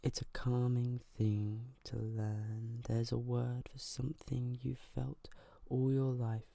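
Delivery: ordinary delivery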